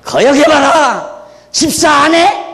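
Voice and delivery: slow, high and loud threat; mock threatening pastor. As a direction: taunt the congregation like a bully preacher. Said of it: "요나의 표적 얘기하면서".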